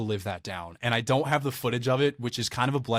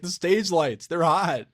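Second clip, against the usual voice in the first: higher-pitched protest in a retold quote; defensive excuse; 7 semitones higher, 4 dB louder, slower than usual. Retelling his defensive excuse.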